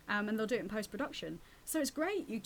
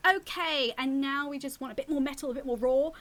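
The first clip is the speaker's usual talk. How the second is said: director's request; 6 semitones higher, 7 dB louder than usual. Retold or imitated. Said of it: "and they go".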